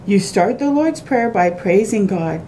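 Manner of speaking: usual speaking voice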